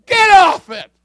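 full high-pitched preacher's shout. Real, not acted. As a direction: bellow it to snap them out of it. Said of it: "where the man can raise his voice".

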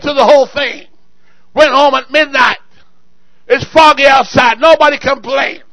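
loud, rolling sermon delivery; full-force preaching. Real, not acted. Pastor preaching to his congregation about the old church, loud and fervent. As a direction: drive each line home to the pews.

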